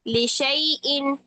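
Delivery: लिशैइन, ai glide, alif silent; Qur'anic recitation. Shown as a demonstration. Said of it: "लीन की सूरत में पढ़ेंगे".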